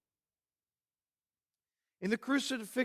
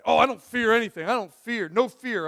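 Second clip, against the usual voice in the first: quick, louder boast; mock bravado; 4 semitones higher, 8 dB louder than usual. Preacher mimics people who claim no fear, mock bravado. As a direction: toss it out loudly, imitating a fearless boaster.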